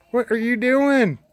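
high, slow scolding call; scolding shout. Real, not acted. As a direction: call her out, loud and slow. Scolding chicken again.

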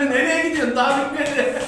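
high, loud laughing call; laughing shout. Real, not acted. Teasing friend, laughing.

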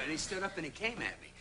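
ordinary talk, nothing marked

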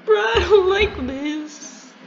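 very high, slow, tearful whine; terrified whimper. Real, not acted. Player in a locker as the monster nears, terrified whimper. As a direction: drag each word out in a tiny frightened squeal.

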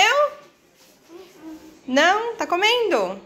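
high sing-song questions. Real, not acted. Talking to child, high voice.

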